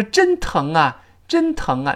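"真疼啊" with ng flowing into 啊; linked 啊 after 疼. Shown as a demonstration. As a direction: let 疼 carry into 啊. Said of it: "我说". (“疼”连读成“啊”)

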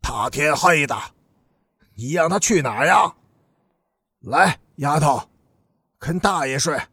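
lightly voiced leering old rogue; a hint of an old street lout; old hoodlum. A narrator's reading. Father propositioning the girl at night, old hoodlum voice. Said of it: "那个旧天桥混出来的老流氓说".

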